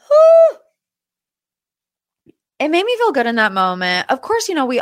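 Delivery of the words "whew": long high whew of relief; loud relieved whew. Real, not acted. Recapper exhaling loudly in relief. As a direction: let it all out in one loud whew.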